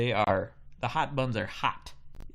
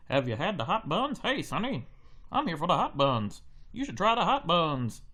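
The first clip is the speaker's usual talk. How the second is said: put-on lightly pitched-up old-time newsie patter; 1930s newsman; 7 semitones higher than usual. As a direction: crowd in line talking like 1930s press men. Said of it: "Everyone talks like they're a, they work for the press in the nineteen thirties".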